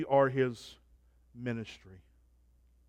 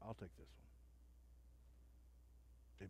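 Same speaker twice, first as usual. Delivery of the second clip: much softer, held-back divine quote; quiet voice of God; 4 semitones lower, 23 dB quieter than usual. Acted. God the Father quietly silencing Peter, softer. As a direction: hush it like a final word. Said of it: "God the Father says".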